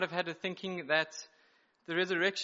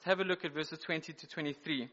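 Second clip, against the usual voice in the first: usual speaking voice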